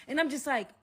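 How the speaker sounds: usual speaking voice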